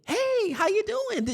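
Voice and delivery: high, slow, sing-song hello; bright cheerful greeting. Retold or imitated. Teacher hiding her tears greets the speaker, high chirpy hello. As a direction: put on a breezy everything's-fine voice. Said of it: "Just like that".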